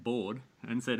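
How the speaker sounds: ordinary delivery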